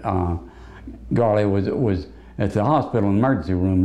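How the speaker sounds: normal talking voice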